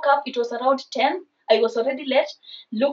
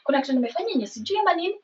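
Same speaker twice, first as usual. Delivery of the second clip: very fast, soft, higher-pitched mumbling; talking to himself; 5 semitones higher, 6 dB quieter, much faster than usual. Retold or imitated. Boyfriend puzzling over what he has done, muttering to himself. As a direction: rattle it off quietly to yourself. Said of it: "he starts talking to himself".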